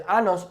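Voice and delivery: "anos" with a plain n; the ñ flattened to n in "anos"; mispronounced años. Shown as a demonstration. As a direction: say "anos" as AH-nos, no y glide. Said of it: "you're saying anuses".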